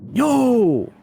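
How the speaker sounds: high startled yelling; frat bro panic. Real, not acted. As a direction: yelp in shock at the ghost. Startled by game ghost.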